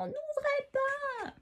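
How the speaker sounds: very high, drawn-out cry, held back in volume; mock shout. A narrator's reading. Storyteller re-showing Emma's chimney warning, a restrained high shout. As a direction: imitate a far-carrying yell without the loudness. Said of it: "qui crie très très fort".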